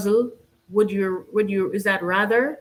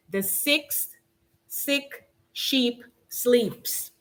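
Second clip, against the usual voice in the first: very slow and soft, each s careful; slow tongue twister; 3 semitones higher, 5 dB quieter, much slower than usual. Shown as a demonstration. Host reading another tongue twister, slow and soft. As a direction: tiptoe through the s sounds slowly. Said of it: "I'll try to go kinda slowly".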